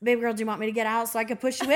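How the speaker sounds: a hint of a practical husband, low and rapid; lightly voiced husband. Husband offers to push her in, lightly voiced low.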